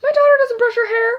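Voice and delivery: high, loud, fast mock rant as the mom; exasperated mom. Fed-up mom telling the hairdresser about her daughter, high loud mock rant. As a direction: shriek it out like a mom at her limit. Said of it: "she was like".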